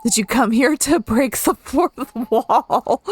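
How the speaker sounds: put-on slow, high, loud Russian-accented line with a laugh. Woman teasing the boy about the fourth wall, laughing Russian accent. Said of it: "this lady has a Russian accent".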